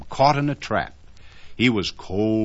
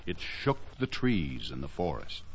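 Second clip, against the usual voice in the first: ordinary delivery, nothing marked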